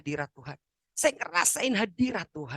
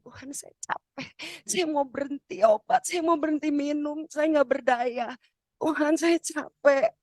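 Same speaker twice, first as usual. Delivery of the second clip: exhausted pleading; 9 semitones higher than usual. Retold or imitated. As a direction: plead worn out, pitch way up. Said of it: "capek sekali, saya bilang". (ratapan lelah memohon, nada tinggi)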